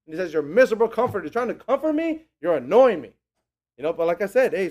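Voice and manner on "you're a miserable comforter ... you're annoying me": imitated exasperated retort; mildly annoyed. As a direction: push back at the would-be comforters. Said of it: "they were annoying him".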